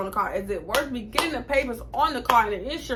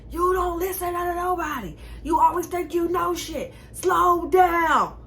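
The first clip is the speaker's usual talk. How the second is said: imitating mom yelling; 9 semitones higher, slower than usual. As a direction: ham up the mother shouting down the phone. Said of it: "she would just be yelling".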